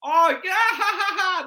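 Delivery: a big theatrical laugh; mock parent laugh. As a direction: laugh it off so the kid feels fine. Parent laughing off kid's fall.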